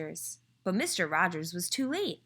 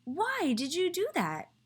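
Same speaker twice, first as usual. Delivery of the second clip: exasperated yell; 12 semitones higher than usual. A narrator's reading. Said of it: "yelled Mister Rogers".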